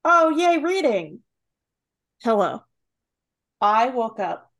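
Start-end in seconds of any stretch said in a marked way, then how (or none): none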